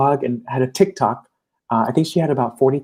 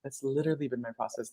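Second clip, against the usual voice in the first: much softer and quicker, sincere; quiet repeat; 14 dB quieter, faster than usual. Real, not acted. Coach repeating her admission to the host, softer and quicker. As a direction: drop the laugh and say it softly to yourself.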